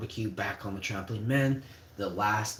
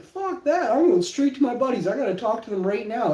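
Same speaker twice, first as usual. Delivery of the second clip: defiant refusal; 12 semitones higher, 8 dB louder than usual. Retold or imitated. Defying teacher.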